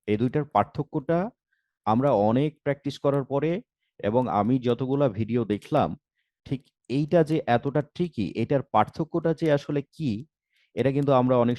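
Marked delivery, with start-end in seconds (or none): none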